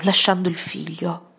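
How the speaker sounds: ordinary delivery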